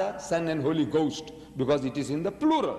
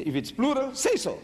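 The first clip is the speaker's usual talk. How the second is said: exasperated demand; 9 semitones higher than usual. Acted. Challenging Bible translators, high and exasperated.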